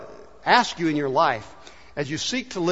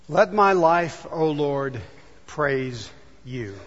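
prayer that drifts to a hush; closing prayer; 4 semitones lower, much slower than usual. Acted.

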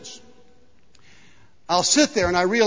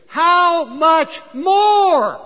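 very slow, loud, high proclamation; climactic preaching shout; 9 semitones higher, 8 dB louder, much slower than usual. Acted. Preacher delivers the last line of Jesus's story, exultant shout. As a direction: stretch every word out at full force.

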